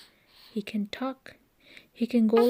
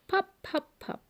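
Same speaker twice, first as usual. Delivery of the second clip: high, bright pops; popping cork sounds; 11 semitones higher, 4 dB quieter than usual. A narrator's reading. Reading to a child, popping like a cork. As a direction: pop it out like a cork. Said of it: "He can go like a cork".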